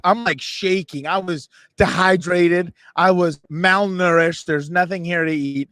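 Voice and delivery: normal talking voice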